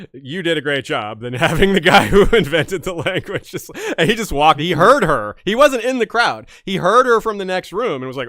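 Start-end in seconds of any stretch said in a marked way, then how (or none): 0.1-3.7 laughing while talking. Real, not acted. Laughing while gushing about author.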